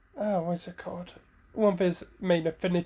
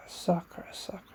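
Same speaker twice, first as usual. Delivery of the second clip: Jiraiya's whispered musing; thinking aloud; 8 dB quieter, faster than usual. Acted. Jiraiya mulling over who should train Sakura, whispering. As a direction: murmur the name to yourself, pondering.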